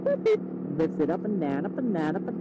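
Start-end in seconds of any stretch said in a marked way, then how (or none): 0.0-0.4 very high squeaky boops; high boop sound. Pretend. Showing the visor switch, squeaky beeps. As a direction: chirp it like a button beep.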